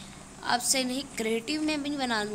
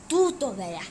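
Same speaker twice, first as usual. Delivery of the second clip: ordinary talk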